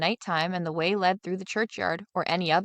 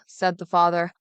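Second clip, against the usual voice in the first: ordinary delivery, nothing marked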